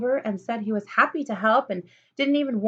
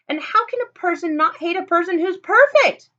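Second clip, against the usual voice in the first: higher, louder exasperated question; 8 semitones higher, 8 dB louder than usual. Acted. Lulu ending her rant at Fleshman, exasperated. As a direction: throw up your hands.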